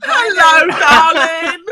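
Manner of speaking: loud, high-pitched mock British greeting; mock British accent. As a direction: call out in a hammed-up British accent. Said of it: "Is that your best British accent?".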